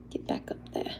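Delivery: very low, soft put-on British accent. Talking to the bandana, playful British accent. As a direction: murmur it in a mock British accent. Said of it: "doing the British accent".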